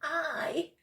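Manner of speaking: high, loud call; shouted. A narrator's reading. Child a long way back, shouting. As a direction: call it out to someone ahead. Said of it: "I had to shout".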